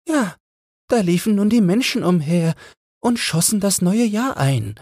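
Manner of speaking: very high, thin bird voice; a tiny chilled sparrow telling its tale; little frozen sparrow. A narrator's reading. Small frozen sparrow telling the others, very high voice. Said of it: "sagte ein kleiner verfrorener Spatz".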